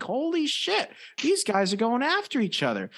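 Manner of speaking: high, stunned holy shit; retold shock at the fight; shocked exclaim. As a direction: blurt it out in disbelief.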